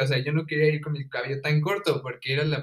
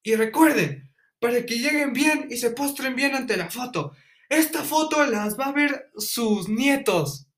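much higher, grandiose mock speech; pompous director; 9 semitones higher than usual. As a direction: ham up a solemn principal's sermon.

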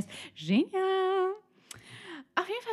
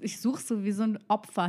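usual speaking voice

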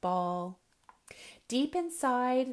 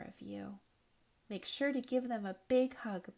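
gentle reassurance; 6 dB quieter than usual. Real, not acted. Storyteller tells children they are loved, reassuring. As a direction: soothe them quietly, sincerely.

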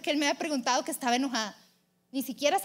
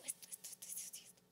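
fading to a near-silent mutter; unvoiced, trailing list; whispered repetition; 27 dB quieter than usual. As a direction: rattle it off under the breath. Listing grievances to God, trailing off.

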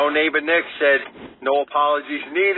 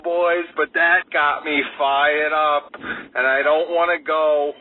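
ordinary talk

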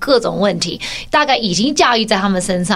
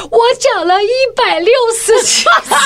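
put-on high, crying child's voice, much higher; whiny sobbing kid pleading her case; tearful little girl; 11 semitones higher, 3 dB louder than usual. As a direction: sob it out as the wronged little sister. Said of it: "崩泪这样子说".

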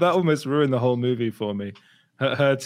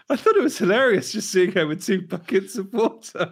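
high-pitched, laughing incredulity; 7 semitones higher than usual. Real, not acted. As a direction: laugh through the words, pitched up. Laughing at an absurd scene.

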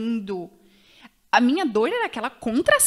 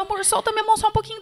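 pleading husband; 9 semitones higher, much faster than usual. Retold or imitated. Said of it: "Ele falava".

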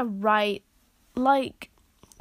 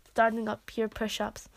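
usual speaking voice

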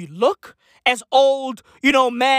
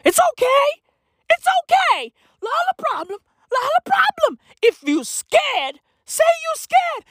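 squeaky, high comforting voice; high mock reassurance. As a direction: soothe in a squeaky way.

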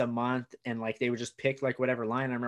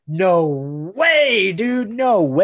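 loud, higher "no way" refusal; 4 semitones higher, 10 dB louder, much slower than usual. Retold or imitated. Comic refusing the offer, loud and raised. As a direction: shout him down, no way.

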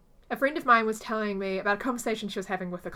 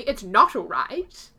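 indignant retort; 8 dB louder, slower than usual. Retold or imitated. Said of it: "And he said to me".

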